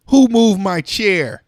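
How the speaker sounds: slow irritated snap; snappy outburst. Acted. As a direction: bark it at the whole room. Snappy grape snaps at someone.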